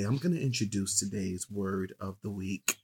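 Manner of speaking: slow, soft, low relaxing voice; soothing sexy voice, low. Real, not acted. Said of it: "This is, this is your sexy voice?".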